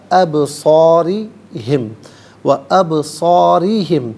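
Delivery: ابصارہم with the ba bouncing, slow and loud; chanted ابصارہم with qalqala; qalqala recitation. Shown as a demonstration. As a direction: let the ba in ابصارہم echo as qalqala. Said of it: "حرف قلقلہ ہے".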